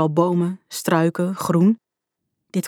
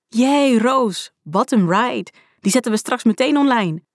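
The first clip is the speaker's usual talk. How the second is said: high, swooping, delighted praise; excited cheering; 6 semitones higher than usual. A narrator's reading. Anne congratulates Roos on the ride, excited cheering. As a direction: cheer a friend on in a raised pitch.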